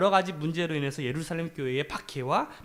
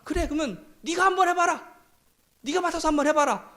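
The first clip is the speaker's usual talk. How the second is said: higher, pointed voice of a fed-up elder; irritated leader; 11 semitones higher than usual. Retold or imitated. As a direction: toss the job back at them. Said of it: "그러니까 이 지도자가".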